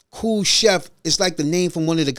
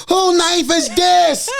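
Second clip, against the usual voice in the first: mock chef shout; 10 semitones higher, slower than usual. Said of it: "I said".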